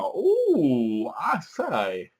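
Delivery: mock posh Englishman; a hammed-up posh ooh; posh exclamation. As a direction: play a startled toff. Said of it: "He's like".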